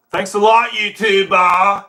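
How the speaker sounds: high, loud sarcastic thanks; mock outrage. Streamer blaming YouTube for the mirrored camera, mock outrage. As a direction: yell it out as a mock complaint.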